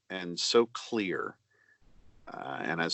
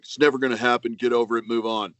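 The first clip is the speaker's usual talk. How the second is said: a lightly louder, dismissive know-it-all voice; mock the smug doubters who tell you to give up; naysayer impression; 5 dB louder, faster than usual. Actor mimicking dismissive online naysayers for the fans, a mocking impression. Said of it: "That was a great impression".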